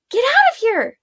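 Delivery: high, loud urgent command; shouting. Acted. Arella sending the little girl away, loud and high. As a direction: shout her away to safety. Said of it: "Arella shouted".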